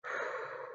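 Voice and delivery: soft, unvoiced blown breath; quiet calming exhale. Shown as a demonstration. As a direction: exhale to steady yourself. Shows breathing through it.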